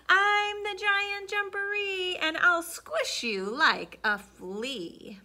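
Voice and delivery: booming, slow, menacing giant threat; loud monster voice. Acted. Hidden giant booming threats at the cat. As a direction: loom over the cat with each word. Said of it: "she heard a loud voice".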